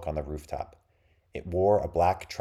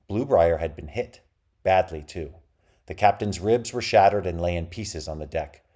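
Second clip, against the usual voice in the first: plain reading voice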